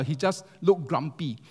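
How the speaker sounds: normal talking voice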